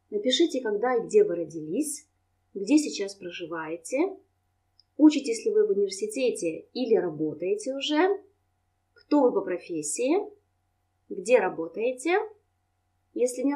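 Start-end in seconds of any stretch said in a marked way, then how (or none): none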